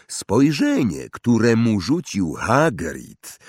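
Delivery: ordinary delivery, nothing marked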